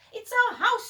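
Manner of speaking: high mouse squeak; squeaking mother mouse. A narrator's reading. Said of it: "squeaked the mother mouse".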